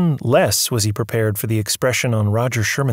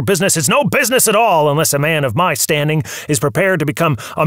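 put-on higher, agitated old mogul ranting; angry old tycoon; 6 semitones higher, 3 dB louder than usual. Movie magnate accuses his visitor of conspiracy, raised and agitated. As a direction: rail at him like a wronged movie boss.

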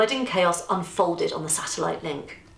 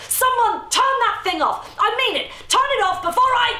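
much higher, louder, fast rage; furious shriek; 5 dB louder than usual. Acted. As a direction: scream at the camera, losing control. Easter raging at camera.

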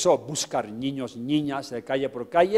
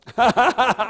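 loud, high burst of laughter; 10 semitones higher, 7 dB louder than usual. Real, not acted. Laughs about teachers hitting pupils. (carcajada aguda y fuerte)

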